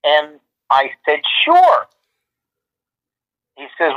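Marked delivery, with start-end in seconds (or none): 1.4-1.9 jumps high with an eager sure; a bright squeaky yes, leaping up. Retold or imitated. Retelling how he said yes to [name], high and eager.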